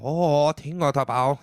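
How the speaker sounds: slow mock Chinese gibberish; fake foreign language. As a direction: drawl out made-up Chinese syllables. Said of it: "you know, something like that".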